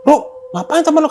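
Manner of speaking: high, loud call across the camp. Retold or imitated. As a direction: shout to ask what's happening. Shouting across at strange scene. (berseru keras dengan nada tinggi)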